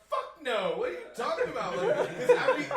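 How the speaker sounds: high, loud, laughing no way; loud laughing refusal. Retold or imitated. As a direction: shout it out through laughter. Refusing offer, laughing loudly.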